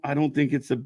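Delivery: ordinary talk, nothing marked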